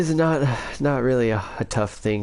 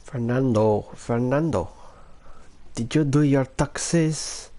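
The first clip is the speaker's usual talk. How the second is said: Filipino accent impression, pitched higher; 5 semitones higher than usual. Shown as a demonstration. Showing off an impression by calling Fernando about his taxes, Filipino accent. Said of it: "Here's my Filipino accent".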